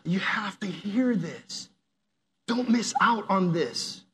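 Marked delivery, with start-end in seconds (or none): 0.0-1.7 slow unvoiced whisper; tender whispered urging. Shown as a demonstration. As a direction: lean in and whisper it like a secret. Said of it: "because I love you".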